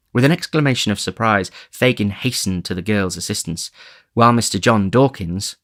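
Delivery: ordinary delivery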